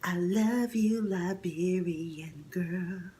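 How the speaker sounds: put-on slow soft whisper of "Liberian girl"; mock whispered love line. The lover whispering sweetly to the vlogger. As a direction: breathe it like a crooner. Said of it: "one says to me".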